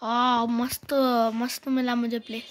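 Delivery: normal talking voice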